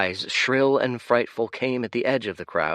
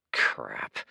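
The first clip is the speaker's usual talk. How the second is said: muttered under breath; 12 semitones lower than usual. Acted. Dorian under his breath.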